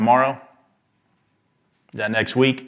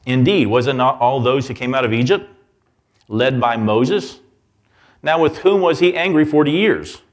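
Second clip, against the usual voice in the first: loud, driving, higher preaching voice; forceful preaching; 3 semitones higher, 5 dB louder than usual. Acted. Preacher pressing scripture on the congregation, loud and forceful. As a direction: preach it out to the back row.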